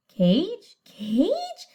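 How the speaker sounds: rising squawked echo; lightly voiced parrot repeat. Parrot echoing the prince, lightly squawked and rising.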